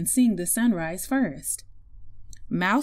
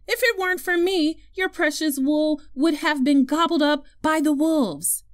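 higher-pitched storybook dog, scolding; raised-pitch protest from a proud dog; indignant dog; 6 semitones higher than usual. Acted. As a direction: argue back as an affronted dog.

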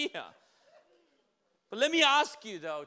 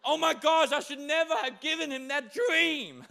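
high, hammed-up dismay; a flustered God regretting his gift, pitched up; God's mock regret; 6 semitones higher than usual. God regretting the dream he gave, high mock dismay. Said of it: "and God's like".

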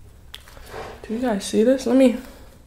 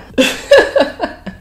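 bright high-pitched giggle; high laugh; 5 dB louder than usual. Real, not acted. Laugh after starting.

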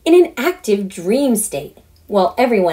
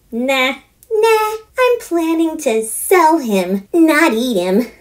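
put-on higher, excited girl voice, slow on perfect fern; eager girl; 8 semitones higher, slower than usual. Daughter announcing her audition idea, eager.

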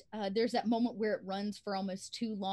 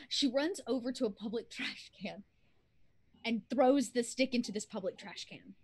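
ordinary delivery

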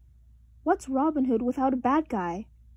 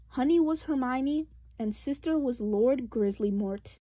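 plain reading voice

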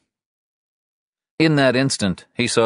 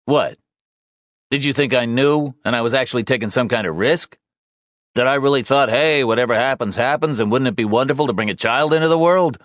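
louder, higher angry husband; 7 semitones higher, 3 dB louder than usual. Acted. Husband lashing out at pregnant wife, angry and loud. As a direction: let the anger take over, sarcastic. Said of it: "the intensity of his anger".

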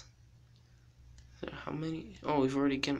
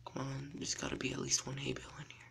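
whispering; 8 dB quieter, faster than usual. Real, not acted. Searching quietly as hunter.

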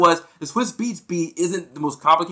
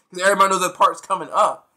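ordinary delivery

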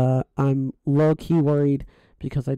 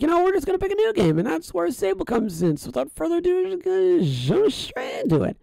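much higher voice, playfully building up; hyped-up kickoff; 13 semitones higher than usual. Real, not acted. Streamer kicking off a new game, hyped and high-pitched. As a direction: launch into it high, stretching out the end.

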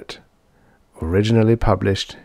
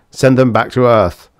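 louder, higher urgent order; restrained shriek; 4 semitones higher, 6 dB louder than usual. A narrator's reading. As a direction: call it out in alarm, short of screaming. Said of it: "he shrieked".

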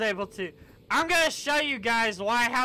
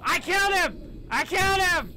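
high, loud victory shout; triumphant shout; 8 semitones higher, 3 dB louder than usual. Real, not acted. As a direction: shout it like you just won. Kills the enemy.

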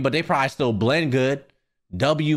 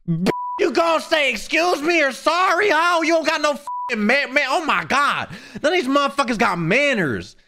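high-pitched exasperated outburst; indignant rant; 10 semitones higher than usual. Acted. Streamer telling off whoever bumped him, indignant. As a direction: rant in high, comic outrage at the culprit. Ranting at rude stroller pushers.

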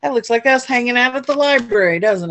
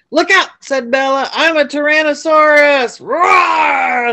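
higher, much louder playful T. rex roar; dinosaur roar; 6 semitones higher, 7 dB louder than usual. A narrator's reading. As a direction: roar like a pretend Tyrannosaurus. Bella playing dinosaur.

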